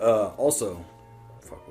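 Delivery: ordinary delivery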